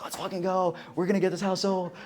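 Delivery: high, excited pitch; hyped up. Shown as a demonstration. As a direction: pump them up like an eager agent. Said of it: "presenting things in a way that are like".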